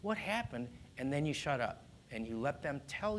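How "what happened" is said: put-on soothing a child after a meltdown. Shown as a demonstration. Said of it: "you say in a soothing voice".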